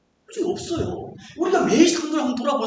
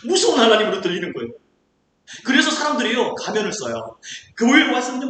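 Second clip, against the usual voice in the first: ordinary delivery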